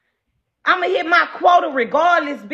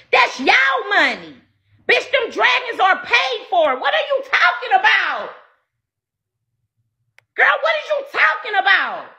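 indignant shout; 6 semitones higher than usual. Real, not acted. Shouting back at viewers' accusations.

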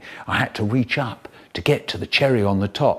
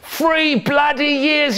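much higher, slow, shouted cry of freedom; overjoyed and near tears; ecstatic shout; 12 semitones higher, 3 dB louder, slower than usual. Acted. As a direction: howl it out like a released prisoner. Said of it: "he said, and started crying".

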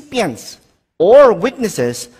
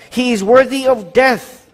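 condemning council; 7 semitones higher, 4 dB louder than usual. Acted.

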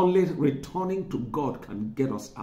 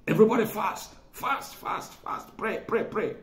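mock frantic command; 7 semitones higher than usual. Mocks panicked call to fast.

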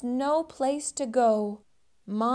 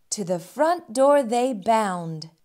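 ordinary delivery, nothing marked

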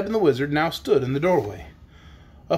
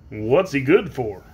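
slow, lower man's voice; questioning man; 4 semitones lower, slower than usual. A narrator's reading. Man asking the horse's use, low voice. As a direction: ask it low and slowly. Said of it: "was the next question".

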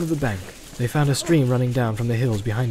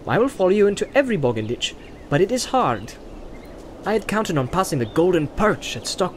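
higher hobbit voice, reluctant and wistful about beer; Pippin, grudging; 5 semitones higher than usual. A narrator's reading. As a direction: give in, but grumble about missing the inn. Pippin's dialogue in read story.

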